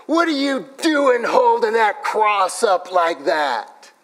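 slow, high, loud mock drunk voice; hammed-up drunk challenge; drunk heckler. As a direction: sneer it like a belligerent drunk. Drunk man confronting street preacher.

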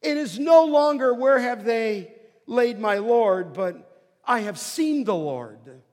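loud, rising preacher's cry; preaching at full force. Acted. Preacher exhorts the beloved congregation, loud and fervent. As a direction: proclaim it to the congregation.

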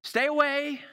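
high, loud cry to keep back; yelled warning. Acted. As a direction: yell it out to warn people away. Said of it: "she would have to yell out".

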